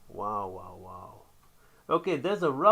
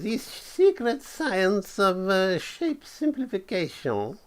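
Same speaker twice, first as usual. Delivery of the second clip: put-on high, slow German genius sliding into French accent; German accent turning French; 7 semitones higher than usual. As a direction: start as a German professor, end as a Frenchman. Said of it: "Turning him into a Frenchman at the end there".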